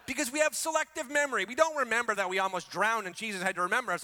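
ordinary talk

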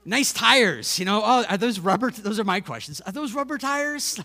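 hammed-up clueless car admirer; clueless small talk. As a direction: gush over tires you know nothing about. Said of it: "you just start saying nice things".

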